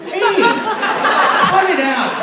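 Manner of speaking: high yelping whine; mock whiny victim. Robbery victim yelping at the robber, high and whiny. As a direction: yelp like a pellet stung you. Victim hit by pellet gun.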